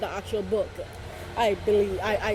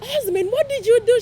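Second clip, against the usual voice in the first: much higher, louder mock scolding; scolding friend Dana; 12 semitones higher, 7 dB louder than usual. Dana scolding the speaker's dad, higher and louder. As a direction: call out the dad, pitched way up. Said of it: "she's like".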